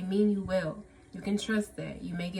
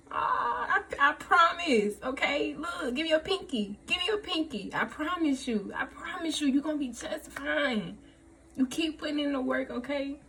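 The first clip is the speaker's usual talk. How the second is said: higher, gentle, earnest promise; earnest reassurance; 6 semitones higher than usual. Real, not acted. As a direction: comfort a worried friend. Reassuring viewers after happy reading.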